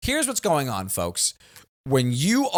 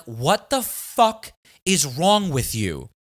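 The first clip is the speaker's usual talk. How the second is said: slow, drawn-out, fed-up disbelief; exasperated outburst; slower than usual. Retold or imitated. To his parents after their absurd question, slow exasperated disbelief. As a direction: stretch each word out like you can't believe them.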